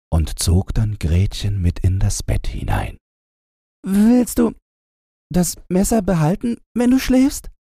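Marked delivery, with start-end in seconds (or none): 3.9-7.5 put-on high, small girl's voice asking; a little girl asking nervously about the knife; timid girl's question. A narrator's reading. Gretchen asks the robber girl about the knife, high girl's voice. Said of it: "fragte Gretchen".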